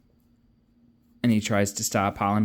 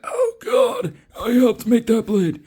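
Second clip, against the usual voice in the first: much higher, aghast cry; horrified realization; 14 semitones higher, 5 dB louder, slower than usual. Retold or imitated. Kel stabbed by a blade he made, high horrified cry. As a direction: gasp it out as the horror dawns.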